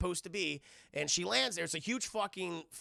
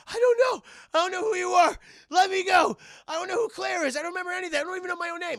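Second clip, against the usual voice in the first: much higher, louder desperate pleading; frantic amnesiac; 12 semitones higher, 11 dB louder, faster than usual. Acted. Amnesiac Claire begging Alice to free her, high frantic cries. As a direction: plead and shout like you remember nothing.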